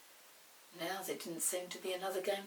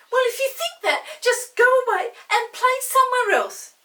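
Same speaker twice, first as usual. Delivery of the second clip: put-on very high, loud cross girl; cross dismissal; 19 dB louder than usual. Molly sends Chinky away, loud and huffy. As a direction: send him off like a huffy sister. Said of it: "said Molly at once".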